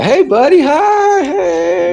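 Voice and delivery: put-on high, excited baby-talk greeting to a dog; gushing dog greeting. Mimicking people greeting a dog.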